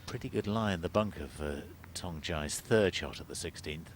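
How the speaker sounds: ordinary talk, nothing marked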